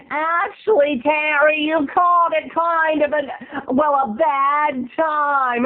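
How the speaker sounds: high, slow breathy mock answer; imitating Laura. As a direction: stretch it out high and coy. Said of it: "she's like".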